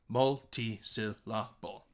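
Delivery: MULTI-SYLLABLE in five separate slow beats. Shown as a demonstration. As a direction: pause between each syllable of Multi-syllable. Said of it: "has five syllables".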